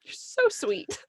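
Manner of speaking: high, strained sugary tone hiding irritation; sweet on the surface, annoyed underneath, pitched high; forced sweetness. Retold or imitated. Frustrated mom answers her daughter's love you, high and forced sweet. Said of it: "all I wanna do is, like, snap at you".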